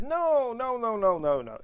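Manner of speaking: put-on Italian accent, high insistent no's. Nini denying the crashes, in an Italian accent. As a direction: protest the way an Italian would. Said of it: "in an Italian accent".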